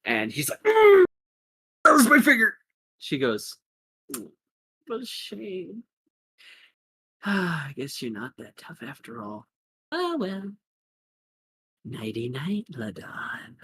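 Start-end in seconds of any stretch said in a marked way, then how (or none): 0.6-2.6 loud, high cry of pain; groan, then yelp it out. Acted. Man with his hand stepped on, pained yelp. 4.1-13.6 soft, taunting mock sympathy; mock-pitying villain. Attacker taunting the fallen Ladon, mock pity. Attacker gloats over beaten man.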